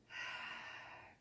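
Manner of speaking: long breathy release sigh; audible sigh. Shown as a demonstration. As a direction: let the breath fall out with a sigh. Teacher models a relaxing exhale.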